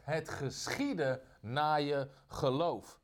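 slow, weighty words of Jesus; slow solemn verdict spoken over the blind man; solemn pronouncement. Retold or imitated. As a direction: pronounce it slowly like a blessing.

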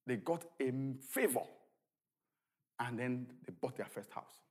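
ordinary delivery

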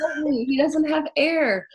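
high, urgent retold plea; child's alarm. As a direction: reenact a sleepwalker alerting the parents. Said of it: "I run upstairs to my parents and I'm like".

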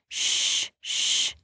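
soft "shh"; hushing. A narrator's reading. Rowan keeps the parrot from squawking, soft hushing. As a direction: hush the bird with drawn breath.

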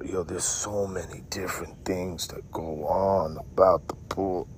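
secretive whisper; whispered aside. Acted. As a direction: drop to a hushed whisper. Sudden whisper about pool.

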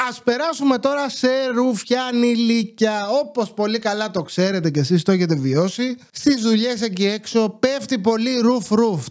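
ordinary talk, nothing marked

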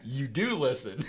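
slow and loud, laughing; proud chuckle through the words; laughing pride. Retold or imitated. Dad quoting himself to daughter, laughing proudly. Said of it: "I'm like".

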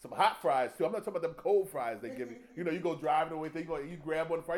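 usual speaking voice

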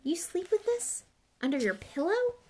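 high, incredulous question; disgusted. A narrator's reading. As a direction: ask in appalled disbelief.

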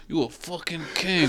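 hushed words through clenched teeth; through gritted teeth. Retold or imitated. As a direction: squeeze it out through your teeth. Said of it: "Started talking through his teeth".

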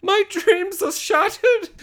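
put-on very high, slow childish wail; hammed-up kid-like despair, drawn out; mock upset. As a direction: squeal it like a devastated kid. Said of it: "he's all upset".

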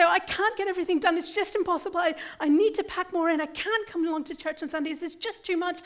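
mock worried believer pleading; hammed-up anxious protest, rushing; anxious complaining voice. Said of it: "Or if you say".

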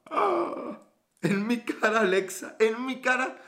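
high-pitched playful gasp of offence; mock outrage. Streamer to Alexa who admits lying, mock outrage. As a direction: exclaim it high, as if scandalised by a friend.

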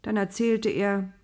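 plain reading voice

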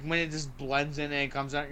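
ordinary delivery

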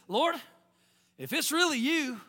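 calling out. Acted. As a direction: call across the water to Jesus. Acts Peter calling out to Jesus.

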